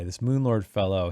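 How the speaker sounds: ordinary talk, nothing marked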